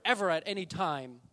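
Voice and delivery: "ever" pressed loud; loud climax; emphatic. Acted. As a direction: push "ever at any time" out loud. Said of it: "he even adds one more word".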